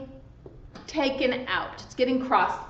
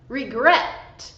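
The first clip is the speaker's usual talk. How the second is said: "regret" with the stress on GRET; second syllable of "regret" stressed; 2 semitones lower than usual. Shown as a demonstration. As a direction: say "regret" slowly as re-GRET, punching the last syllable. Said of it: "the stress is on the second syllable".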